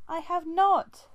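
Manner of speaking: high, loud, slow furious spit; spat denial. A narrator's reading. Squirrelpaw denying she lied to Brambleclaw, spitting angry. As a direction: spit the denial back at him. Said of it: "Squirrelpaw spat".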